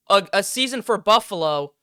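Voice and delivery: ordinary delivery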